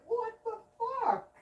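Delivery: high, slow disbelieving; incredulous. Retold or imitated. Him reacting to neighbor's news, high slow disbelief. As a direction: drag it out in disbelief. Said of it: "I'm like".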